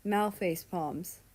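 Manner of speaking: usual speaking voice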